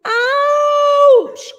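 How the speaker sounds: high, loud yelp of pain; pained cry. A narrator's reading. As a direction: yelp it as she gets hit. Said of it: "called out the polar bear".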